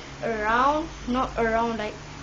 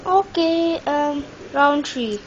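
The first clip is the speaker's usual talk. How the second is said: ordinary delivery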